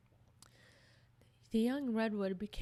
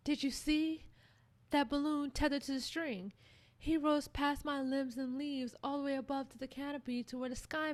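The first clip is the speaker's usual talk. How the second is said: put-on higher, eager child-like tree voice, fretful; a young tree pouring out its worry, higher; upset young tree; 4 semitones higher than usual.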